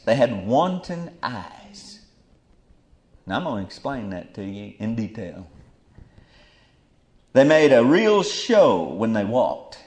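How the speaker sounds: usual speaking voice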